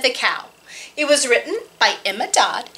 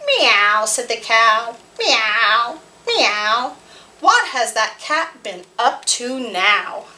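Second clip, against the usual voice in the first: put-on lively read-aloud voice for small children; storytime narration. Librarian reading a farm picture book to children, lively.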